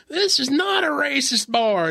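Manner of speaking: high, loud, drawn-out mock denial; bar owner impression. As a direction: imitate a riled bar owner hollering back. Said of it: "he talked like this".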